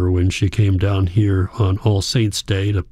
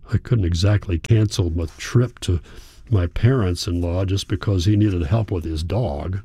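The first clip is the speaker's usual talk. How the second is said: usual speaking voice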